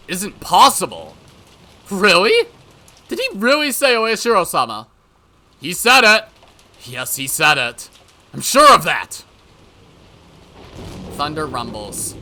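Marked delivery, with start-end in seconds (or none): 0.1-9.2 loud, insistent back-and-forth; agitated disbelief. Acted. Questioning what Arakawa said of the curse, agitated. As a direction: press it in alarm.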